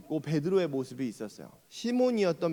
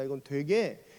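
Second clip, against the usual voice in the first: usual speaking voice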